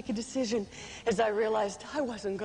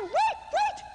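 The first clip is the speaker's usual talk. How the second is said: shouting wait. Retold or imitated. Calling to the ship crew, high desperate shout. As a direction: yell after the leaving ship. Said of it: "and I cried".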